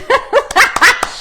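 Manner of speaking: a loud high burst of laughter; high bright laugh. Real, not acted. Shane sends angry faces.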